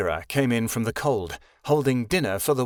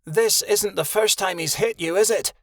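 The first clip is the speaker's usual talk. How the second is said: asked angrily; 6 semitones higher than usual. A narrator's reading. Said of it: "Shadowin asked angrily".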